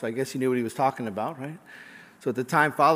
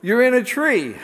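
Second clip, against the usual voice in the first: loud, high punchline delivery; calling back up; 9 semitones higher, 8 dB louder than usual. Acted. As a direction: holler the obvious answer upward. Walker calls back up to parachutist.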